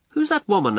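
put-on high-pitched English-accented question. A narrator's reading. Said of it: "say to me in his English accent".

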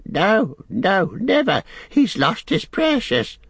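high creature wail; Gollum, distraught. Acted. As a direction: wail the refusal in anguish.

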